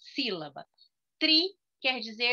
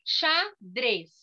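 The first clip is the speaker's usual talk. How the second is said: "Xadrez" divided into xa and drez; short pause inside "Xadrez"; teacher counting two beats in "Xadrez"; syllable by syllable. Shown as a demonstration. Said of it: "vendo quantas vezes você falou".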